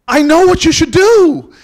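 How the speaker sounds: very loud, high, drawn-out declaration; booming proclamation. Acted. Miss Jones proclaiming her sudden revelation to the narrator, booming. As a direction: belt it out slowly like a revelation. Comic retelling, line repeated at full voice.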